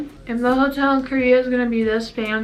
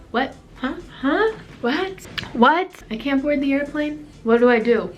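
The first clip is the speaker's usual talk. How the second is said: high, rising baffled questions; retold disbelief; 5 semitones higher than usual. Passenger told she can't board the airplane, acted-out disbelief. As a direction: act out stunned repeated questions, pitched up.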